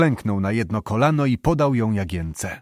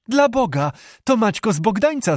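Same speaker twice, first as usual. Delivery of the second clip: surprised exclamation; 8 semitones higher than usual. A narrator's reading. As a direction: call out in sudden glad surprise. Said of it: "zawołała Jagienka". (zdziwiony okrzyk, wyżej i głośniej)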